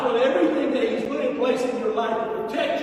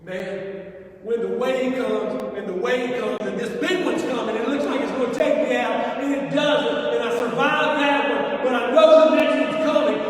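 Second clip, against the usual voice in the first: ordinary talk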